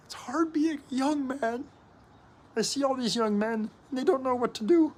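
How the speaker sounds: a strained high sing-song lament; high mock lament. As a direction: lift the voice way up and sigh it out like the song. Voicing the song's lament.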